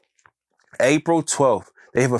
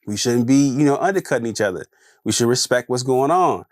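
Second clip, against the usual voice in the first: usual speaking voice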